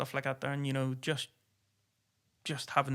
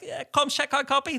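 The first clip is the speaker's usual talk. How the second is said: much higher, louder breezy radio check; casual radio call; 12 semitones higher, 6 dB louder than usual. Retold or imitated. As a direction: toss it off like a relaxed pilot. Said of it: "was like".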